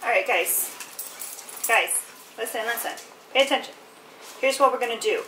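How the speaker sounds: playful pet-talk to the dogs; coaxing the dogs to listen. Real, not acted. Addressing her dogs about chores.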